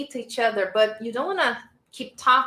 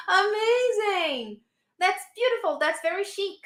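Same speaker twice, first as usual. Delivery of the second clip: amazed praise; 8 semitones higher than usual. Real, not acted. Teacher praising a student's answer, amazed. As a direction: gush it, pitched high and stretched.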